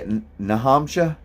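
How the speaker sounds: put-on "Nahamsha" in a light New Hampshire accent, r dropped and vowels run together; playful New Hampshire accent on "Nahamsha". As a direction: imitate how New Hampshire locals say "Nahamsha". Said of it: "how do they say it?".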